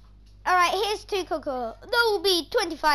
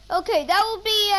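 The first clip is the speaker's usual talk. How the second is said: normal talking voice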